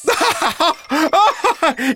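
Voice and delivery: high-pitched ticklish laughing fit; helpless tickled laughter. A narrator's reading. Professor tickled by his own robot, laughing helplessly. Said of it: "shaking with laughter".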